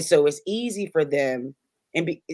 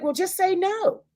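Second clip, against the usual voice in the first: much higher, mocking voice of outsiders; mock advice. Venting host mocks outsiders' easy advice, high mock voice. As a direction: parrot the easy advice people give. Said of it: "And it's like".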